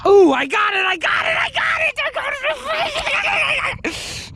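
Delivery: real triumphant squeal; excited hit. Real, not acted. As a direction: yell the win as it lands.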